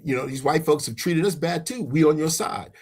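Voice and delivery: normal talking voice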